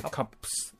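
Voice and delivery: カップス ending in a crisp "psu"; voiceless s ending. Shown as a demonstration. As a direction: pop the p in カップス, then an unvoiced s. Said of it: "じゃあ、いつスになるのっていうと".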